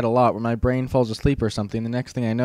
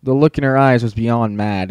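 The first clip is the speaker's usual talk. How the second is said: ordinary delivery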